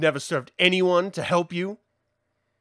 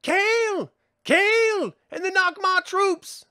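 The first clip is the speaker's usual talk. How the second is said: excited calling out; 11 semitones higher than usual. Acted. Spotting the rescuers and soldiers.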